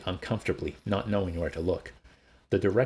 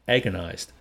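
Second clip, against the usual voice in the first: usual speaking voice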